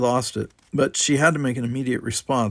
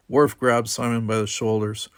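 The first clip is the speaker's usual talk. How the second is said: usual speaking voice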